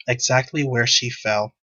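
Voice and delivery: normal talking voice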